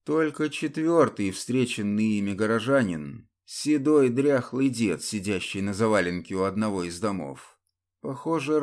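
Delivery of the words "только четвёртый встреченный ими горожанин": plain reading voice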